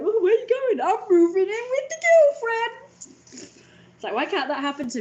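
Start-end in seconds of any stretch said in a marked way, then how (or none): 0.0-0.8 high mock voice; mock-high voice asking dad; imitating the question. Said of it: "he was like". 0.8-2.8 high, loud mock dad; dad announcing he's leaving, mock high; imitating dad. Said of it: "he was like".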